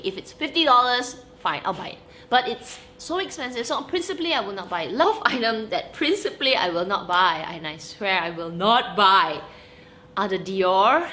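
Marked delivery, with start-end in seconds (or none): none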